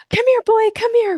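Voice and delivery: squeaky puppy-calling voice; high-pitched dog calling. Shown as a demonstration. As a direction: call your dog like you're thrilled to see it. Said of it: "like, high pitch".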